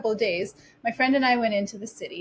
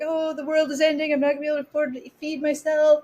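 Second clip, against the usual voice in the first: mock despair; 6 semitones higher, faster than usual. Mocking her past panic.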